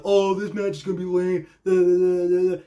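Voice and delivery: mock whiny complaint trailing into da-da-da; dismissive mocking imitation; mocking naysayers. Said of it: "dumbasses out there that were like".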